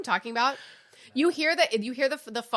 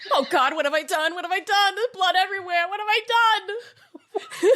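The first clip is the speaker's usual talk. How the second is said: higher, louder mock panic, laughing through it; hammed-up panic; 9 semitones higher, 5 dB louder than usual. Pretend dad panicking after the shooting, hammed-up and laughing.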